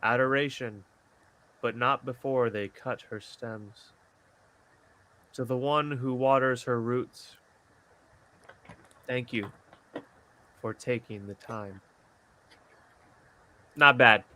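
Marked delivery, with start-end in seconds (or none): 0.0-11.8 poem reading. Acted. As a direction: read it like a tender poem. Reads his poem aloud.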